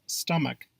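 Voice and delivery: stomach said carefully as STUM-uk; proper pronunciation. Shown as a demonstration. Narrator teaching a tricky word, stomach pronounced STUM-uk. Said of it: "the proper pronunciation is".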